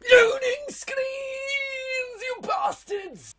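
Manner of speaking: high, drawn-out outraged shout; mock outrage. Cursing the game's loading screens, mock outraged. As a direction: yell it sky-high in mock fury.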